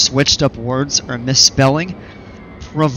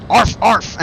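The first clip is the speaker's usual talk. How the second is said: high playful arf, arf like a small dog; playful barking; 8 semitones higher than usual. Pretend. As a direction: bark like a puppy for a laugh. Playful dog bark imitation.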